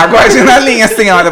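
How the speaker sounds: high, loud call-centre recording voice; phone menu voice. Pretend. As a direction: play the hold message over the phone. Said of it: "vou botar a musiquinha". (voz de gravação de espera, aguda e alta)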